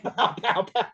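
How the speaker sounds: laughing pow pow sound effects; playful imitated gunfire; mock gunshots. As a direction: spit out gunshot noises through a laugh.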